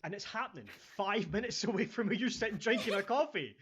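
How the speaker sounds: loud, high-pitched incredulous outburst; comic exasperation, voice climbing high; exasperated rant. Retold or imitated. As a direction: build to a squeaky, disbelieving peak. Mock exasperation at oblivious people.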